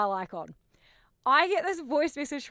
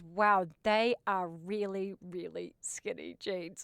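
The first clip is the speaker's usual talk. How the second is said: unimpressed verdict; 3 semitones lower, 7 dB quieter, slower than usual. Retold or imitated. Stylist friend's unimpressed verdict, retold.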